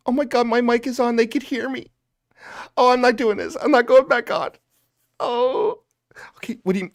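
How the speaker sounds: high, hammed-up tearful voice; mock whimpering. Mock streamer afraid to go back on air, high tearful whimper. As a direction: whimper pitifully, begging to be reassured.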